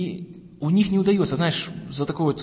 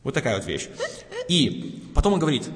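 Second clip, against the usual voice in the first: normal talking voice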